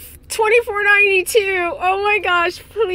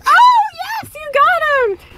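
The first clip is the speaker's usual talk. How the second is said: high, loud cheer; thrilled shout of joy; 1 excited cheer; 13 semitones higher, 6 dB louder, slower than usual. Real, not acted. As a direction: celebrate the catch. Partner catches the Dragonite.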